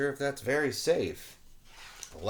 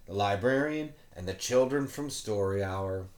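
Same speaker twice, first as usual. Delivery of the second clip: ordinary delivery, nothing marked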